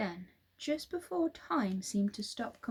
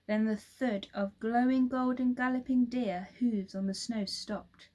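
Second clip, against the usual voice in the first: usual speaking voice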